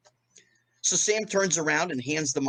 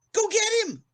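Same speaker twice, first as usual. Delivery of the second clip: high goading shout; 3 dB louder than usual. Retold or imitated. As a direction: go get him, pitched way up. Repeated goading line.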